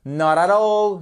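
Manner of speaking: NOT AT ALL flowing as a single word; run together as one word. Shown as a demonstration. Teacher demonstrates the phrase as one word, run together. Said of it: "comme si c'était un seul mot".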